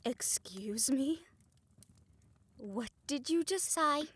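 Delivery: slow, cold challenge; indignant, deliberate demand; offended disbelief. Acted. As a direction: make him repeat the insult, slowly. Offended reaction to a slur.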